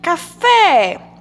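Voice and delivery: Café with a long, loud "fé"; stress on the last syllable of Café. Shown as a demonstration. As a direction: stretch and punch the final syllable of Café. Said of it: "é o fé, porque tá acentuada". (Café com a sílaba final forte e alongada)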